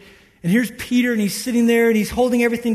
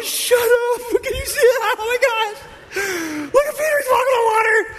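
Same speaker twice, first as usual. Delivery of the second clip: very high, hammed-up astonishment; amazed shrieking; 14 semitones higher than usual. Guys in the boat watching Peter walk, high mock shrieks. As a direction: squeal it like astonished onlookers.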